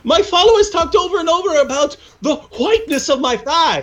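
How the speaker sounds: high, exaggerated comic voice; Muhammad voice. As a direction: ham up a solemn prophet. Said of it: "So Muhammad would go".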